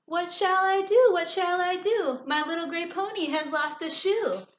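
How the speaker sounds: high alarmed cry, easing off; man crying again. A narrator's reading. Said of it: "he cried again".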